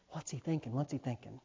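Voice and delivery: hushed thought. Retold or imitated. Preacher recalling his anxious thoughts, hushed. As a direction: think it to yourself, barely aloud. Imagined thought while begging.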